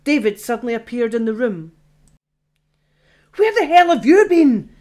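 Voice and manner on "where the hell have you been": high, loud, exasperated blurt; loud exasperated demand; exasperated outburst. Acted. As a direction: snap it out, fed up and worried. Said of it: "blurted out in exasperation".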